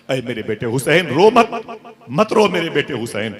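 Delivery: high, loud pleading consolation; impassioned preaching. Acted. Prophet telling weeping Hussain not to cry, loud impassioned preaching. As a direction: cry out the comfort.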